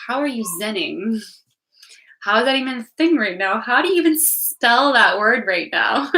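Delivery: exasperated rant. Retold or imitated. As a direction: build up an incredulous rant at him. Retold rant at zen partner.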